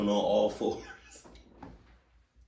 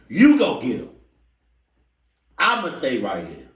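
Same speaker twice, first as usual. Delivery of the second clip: high, loud, indignant comeback; loud retort; 5 semitones higher, 9 dB louder than usual. Acted. Reactor handing mom's order back to her, loud indignant retort. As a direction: punch it back in mock outrage.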